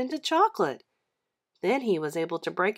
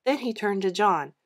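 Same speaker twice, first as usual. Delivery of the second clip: usual speaking voice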